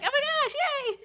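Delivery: very high, squeaky little cheer; mock excited cheer. Bystander caught up in others' excitement, high mock cheer. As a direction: imitate giddy delight way up high. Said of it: "I felt very excited".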